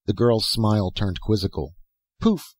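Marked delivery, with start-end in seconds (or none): 2.2-2.5 high pouting Poof; impudent retort. A narrator's reading. Girl retorting at Dan with an impudent pout. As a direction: toss it off with a playful pout. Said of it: "she retorted with an impudently lovely pout".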